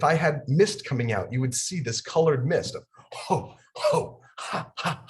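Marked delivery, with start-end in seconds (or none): 3.3-5.1 sharp breathy laughs pushed from the diaphragm; forced laughter exercise. Shown as a demonstration. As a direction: laugh hard from the belly. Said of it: "that's to get your going".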